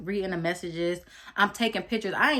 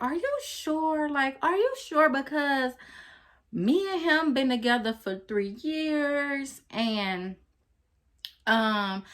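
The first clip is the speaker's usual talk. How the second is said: put-on much higher, slow pleading girlish voice; imitating thing two; 7 semitones higher, slower than usual. Thing two insisting she and her man are in love, high pleading imitation. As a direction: plead like someone who can't believe it. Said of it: "thing two was like".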